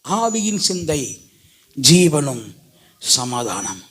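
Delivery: low, unhurried voice falling to softness; calm, hushed assurance. Real, not acted. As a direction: settle gently downward, phrase by phrase. Gentle answer after thundering about death. (தாழ்ந்த அமைதியான குரல்)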